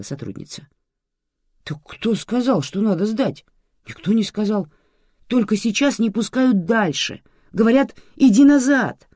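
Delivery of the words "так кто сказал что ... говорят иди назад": high, loud protest; frustrated man raising his voice; exclaiming. A narrator's reading. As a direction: exclaim in helpless indignation. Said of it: "воскликнул мужчина".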